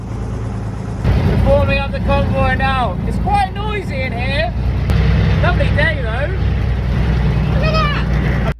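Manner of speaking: loud and high over engine noise. Real, not acted. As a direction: project over the rumble of engines. Speaking over convoy engine noise.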